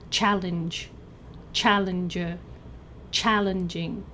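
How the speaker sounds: "challenge, challenger, challenging", each stressed on the first syllable; same-syllable stress. Shown as a demonstration. Teacher shows a fixed pattern, first-syllable stress on "challenge, challenger, challenging". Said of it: "stress is always on the same syllable".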